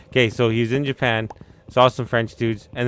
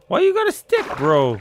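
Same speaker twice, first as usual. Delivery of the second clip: startled question; 8 semitones higher, 4 dB louder than usual. Real, not acted. Reacting to game character.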